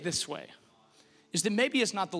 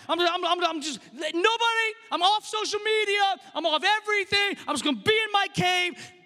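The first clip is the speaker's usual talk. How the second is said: mock giving up; 9 semitones higher than usual. Acted believer fleeing to cave.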